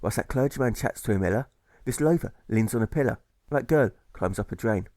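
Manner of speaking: ordinary delivery, nothing marked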